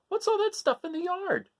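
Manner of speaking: put-on high, puzzled kid voice; Gregory's high surprised voice. Young goat to his parents about the junk, high and puzzled. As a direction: point at the junk in wonder. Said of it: "he said".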